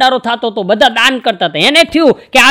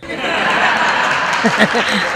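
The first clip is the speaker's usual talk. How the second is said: breathy laugh; 2 semitones lower, 5 dB quieter than usual. Real, not acted. Own laugh after the punchline.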